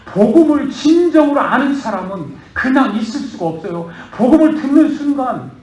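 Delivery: loud, high, impassioned sermon; fervent preaching. Acted. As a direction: preach it hard to the congregation. Pastor preaches at full force. (큰 소리로 열정적으로 설교)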